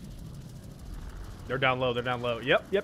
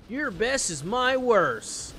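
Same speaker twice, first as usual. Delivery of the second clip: high, slow put-on taunt; 6 semitones higher, much slower than usual. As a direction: drag out a mocking boast. Taunting quote in odd voice.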